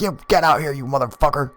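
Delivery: high-pitched retold order; mock angry holler. Man ordering his friend out, mock hollering. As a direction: call him out in a high, cross voice.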